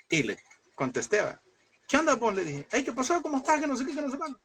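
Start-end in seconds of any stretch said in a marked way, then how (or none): 1.9-4.4 high-pitched, breezy hello into the phone; chirpy phone greeting. Retold or imitated. Preacher acting out his call to a friend, high chirpy greeting.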